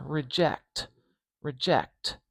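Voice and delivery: reject, reject, k and t tight together; slow careful word demo. Shown as a demonstration. Teacher repeating reject for the kt cluster. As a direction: model reject with the kt cluster clear. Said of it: "Right next to each other".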